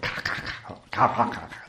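KA, KA, KA as repeated throaty k's; string of soft, voiceless guttural KA sounds; German-style gutturals. Shown as a demonstration. Mimicking how many gutturals German has, repeated throaty KA. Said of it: "German has so many gutturals".

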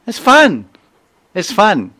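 put-on high, loud child's glee; delighted child. The boy calls down from the swing in a high gleeful voice. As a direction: squeal it like a happy kid.

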